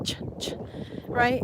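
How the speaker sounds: ordinary talk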